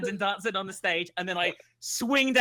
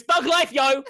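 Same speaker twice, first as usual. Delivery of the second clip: mock thug shout; 10 semitones higher, 8 dB louder, slower than usual.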